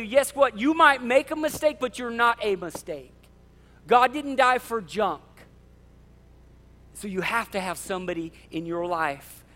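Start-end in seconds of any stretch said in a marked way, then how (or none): 0.0-5.2 louder, higher, insistent friend; emphatic encouragement. Acted. A pastor voicing a friend who refuses to let you quit, emphatic. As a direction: insist they are no mistake.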